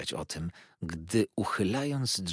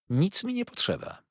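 dry and firm; 11 semitones higher than usual. A narrator's reading. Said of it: "sucho i stanowczo rzekła ciotka".

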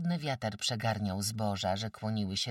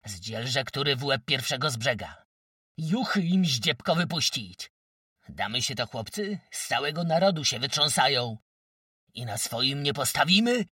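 loud, raised, angry crowd calls; egging-on shouts; 5 semitones higher, 8 dB louder than usual. A narrator's reading. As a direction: call out to rile up the others. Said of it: "wołali drudzy, zachęcając się".

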